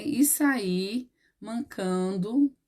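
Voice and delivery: very slow, drawn-out dictation; slow teacherly model answer; dictating. Shown as a demonstration. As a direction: stretch each word for pupils writing along. Dictates rewrite to pupils.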